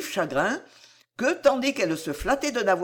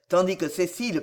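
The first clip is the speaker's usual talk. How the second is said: ordinary delivery, nothing marked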